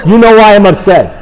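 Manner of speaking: loud, high-pitched disbelief; retold indignant cry of disbelief; incredulous outburst. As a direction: burst out in mock astonishment. Acts incredulous outburst at sponsor.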